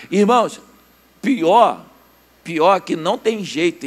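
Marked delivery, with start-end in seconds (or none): none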